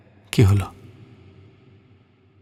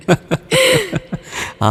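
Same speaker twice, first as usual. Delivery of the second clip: high amused laugh. Acted. Amused laugh after teasing.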